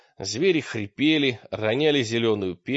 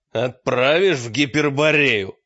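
loud, raised menacing demand; forceful demand; 6 dB louder than usual. A narrator's reading. Мрак has the hermit by the throat, loud demand. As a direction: demand it loudly, with threat.

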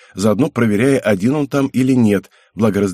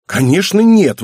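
put-on high, loud girlish indignation; offended teenage daughter's outburst; indignant; 10 semitones higher, 7 dB louder, slower than usual. A narrator's reading. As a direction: protest hotly, voice shooting up. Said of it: "возмутилась в ответ дочь".

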